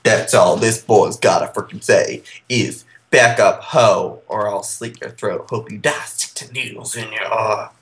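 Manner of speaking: low, menacing mock threat; demonic threat.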